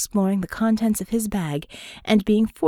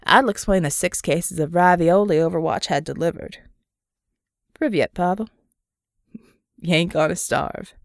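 lazy, confident Southern greeting; 3 semitones lower than usual. Acted. Murdoch jokes about ravioli.

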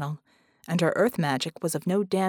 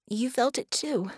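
lightly voiced woman, higher pitch; a hint of her character voice, higher; Bella's voice; 5 semitones higher than usual. A narrator's reading. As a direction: pitch it up for her anxious question.

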